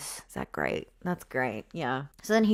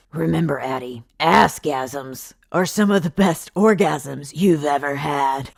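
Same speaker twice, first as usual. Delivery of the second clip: mock pep talk; 6 dB louder than usual. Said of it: "I'm a professional".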